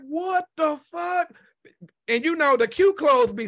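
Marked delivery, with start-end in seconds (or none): 0.1-1.3 amazed. Retold or imitated. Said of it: "I'm like".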